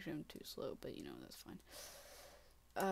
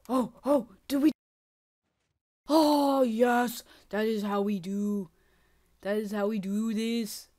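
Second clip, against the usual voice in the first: triumphant cheer; 12 dB louder than usual. Real, not acted. Gamer cheering at his screen after landing the jump. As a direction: celebrate the landing.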